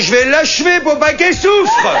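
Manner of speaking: half-laughing shout, pitched high; hammed-up hunter's resolve, shouted; mock noble shout. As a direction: announce the mercy kill with a laugh. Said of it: "Je dis".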